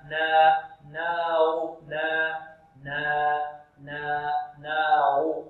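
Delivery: slow, held "نا" with an open a, then "نار"; isolated open syllable. Shown as a demonstration. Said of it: "مجردة عن الراء". (تدريب بطيء على "نا" مجردة عن الراء)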